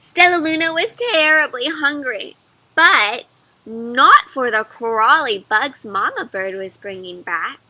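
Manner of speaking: ordinary talk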